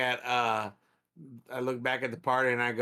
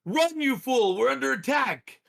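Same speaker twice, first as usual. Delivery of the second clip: high, loud alarmed yell; urgent warning shout; 13 semitones higher, 7 dB louder than usual. Acted. Player warning the big guy of attack, high urgent shout.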